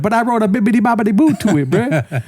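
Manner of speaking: high, quick mock-writer whine; pleading writer. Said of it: "one of the writers is like".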